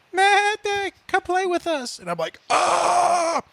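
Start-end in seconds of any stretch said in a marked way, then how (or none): 0.1-2.0 put-on high-pitched eerie child voice; creepy toy voice. IPad game calling out in the dark, creepy high voice. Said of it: "and it goes". 2.5-3.4 high drawn-out "ah" scream; mock scream of fright. Dad spooked by the voice in the dark, mock scream.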